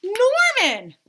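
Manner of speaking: high, loud cry of discovery; startled cry of the name; surprised. Acted. Kid spotting the fish in the dark, high loud surprise.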